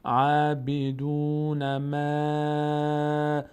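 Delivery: عبدون ما very slow, stretching the long vowels; madd stretched. Shown as a demonstration. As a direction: pull out the آ in ما. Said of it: "مد داره باید کش بدیم".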